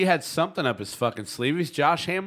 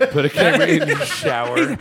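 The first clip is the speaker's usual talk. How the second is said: laughing; 4 semitones higher, 6 dB louder than usual. Real, not acted. Repeats line, laughing.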